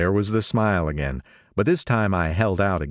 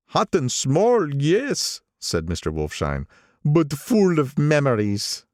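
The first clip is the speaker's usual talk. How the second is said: put-on higher, nostalgic old man; old gangster voice; 8 semitones higher, slower than usual. Old gangster speaking to the narrator over lunch, higher voice. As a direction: pitch him up as the old racketeer.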